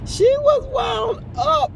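high, loud, drawn-out exclaiming; amused exclamation. Real, not acted. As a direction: stretch it out, high and loud, after a laugh. Laughing exclamation about hyper child.

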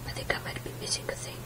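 voiceless, fast whisper. Real, not acted. To himself while searching, in a fast whisper. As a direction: hush it to yourself, quick. Muttering to self.

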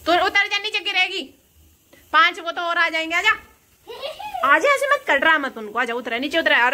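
urgent call, afraid she'll fall. Real, not acted. Mother calls her daughter off the ledge, loud and high. Calling child down from a height.

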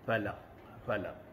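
fala said as pala, soft and low; copying elderly students' pa sound; imitating pala. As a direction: mimic old folks saying fala as pala. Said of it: "masih mengekal lagi".

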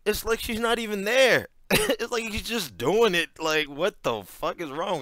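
can't believe it, laugh through it; incredulous outburst. Real, not acted. Reacting to a bad chat script, loud and laughing.

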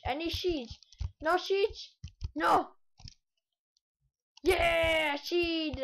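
put-on high, loud silly-accent exclamations; mock-desperate outburst. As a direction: cry out over it in a goofy accent. Mock outburst over finding seeds.

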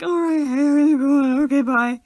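panicked overeager hello and hasty goodbye; freaking out. Pretend. Flustered podcaster greeting a listener in the street, freaking out. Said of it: "I think I would freak out".

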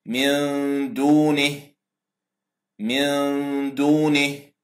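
drawn-out من دونه with held ghunna; nasal ikhfa demo. Shown as a demonstration. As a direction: recite من دونه twice with a long nasal nun. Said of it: "نون ساكنة جاء بعدها حرف الدال".

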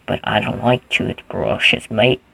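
slow put-on Australian accent. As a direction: draw it out like a mock Australian. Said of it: "Are you Australian now?".

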